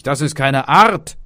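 loud, high burst of anger; sharp, shouted rebuke; angry father. A narrator's reading. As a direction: snap it out as a furious father. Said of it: "sprach der Vater zornig".

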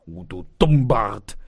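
loud, exasperated insult; loud fed-up exclamation; father calling out. A narrator's reading. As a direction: cry it out as a scolding father. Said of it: "rief der Vater".

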